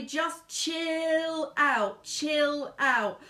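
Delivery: long, lingering CHILL, urging her to relax; drawn-out plea. Real, not acted. Medium pleading with Jenny to stop worrying, drawn-out plea. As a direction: stretch CHILL out very slowly.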